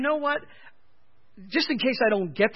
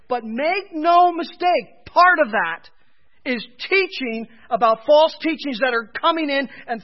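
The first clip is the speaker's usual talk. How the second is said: emphatic warning; 3 semitones higher, 8 dB louder than usual. Real, not acted. Preacher warns congregation.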